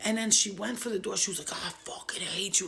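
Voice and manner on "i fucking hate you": rough whispered mock scream; imitated screaming. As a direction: growl it as a half-volume screamo scream. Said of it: "she was screaming".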